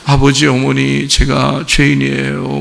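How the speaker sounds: understated weepy imitation; mock tearful confession. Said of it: "펑펑 흘리면서".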